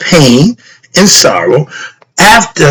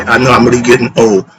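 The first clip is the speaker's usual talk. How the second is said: ordinary delivery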